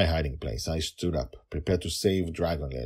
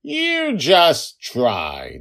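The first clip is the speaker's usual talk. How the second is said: high, loud, very slow taunt; girl yelling; 12 semitones higher, 11 dB louder, much slower than usual. Acted. Girl dares the stomping goblin, loud taunt. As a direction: dare him, stretching each word. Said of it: "Dragon Lil yelled".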